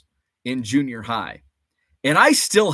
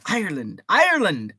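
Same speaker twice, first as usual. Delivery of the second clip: terrible Irish accent; 4 semitones higher, 3 dB louder than usual. Shown as a demonstration. Host echoing a viewer's Irish-born dad, bad Irish accent. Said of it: "That's my terrible Irish accent".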